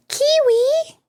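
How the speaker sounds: high cooing voice to a cat. Real, not acted. Baby talk to the cat.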